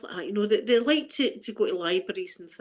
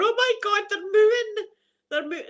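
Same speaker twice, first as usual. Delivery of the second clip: high, louder panicky cry; panicked shriek; 12 semitones higher, 5 dB louder than usual. Retold or imitated. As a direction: squeal in mock terror at the cows. Panicking at cows while driving.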